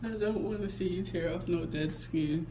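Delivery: ordinary delivery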